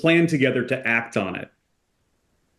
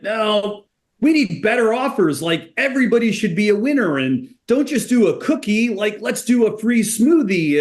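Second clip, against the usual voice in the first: high-pitched, worked-up group pushing for more; excited franchisees; 8 semitones higher than usual. Retold or imitated. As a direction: imitate excited operators clamoring, pitched up. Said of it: "they're all excited about this program".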